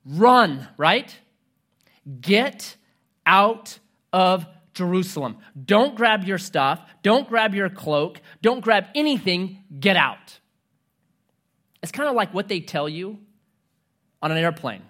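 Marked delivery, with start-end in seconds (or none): 0.1-0.7 loud, urgent command; urgent shout. Acted. Jesus warning followers to flee, a loud urgent shout. 2.2-10.4 urgent command. Acted. Preacher voicing Jesus' warning.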